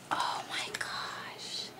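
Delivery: slow, very soft whisper; breath-only amazement; awed whisper. Real, not acted. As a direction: whisper it slowly, stunned. Stunned by tiny tabs.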